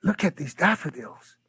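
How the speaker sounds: hushed, high-pitched wonder; retold amazement. Man showing his friend glowing daffodils, amazed. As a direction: marvel and urge him to look.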